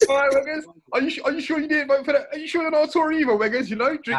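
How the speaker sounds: high, loud, fast put-on voice; ribbing Weggers about voting Tory, high and loud; mock interrogation voice. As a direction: grill him jokingly in a squeaky tone. Mock-accusing friend of being Tory.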